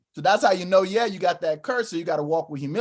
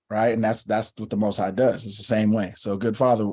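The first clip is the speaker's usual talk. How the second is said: ordinary talk, nothing marked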